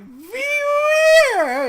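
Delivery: high shrieking Bewear cry, loud; Pokemon cry. Retold or imitated. Imitating Bewear crying its own name as it lands. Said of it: "He's like".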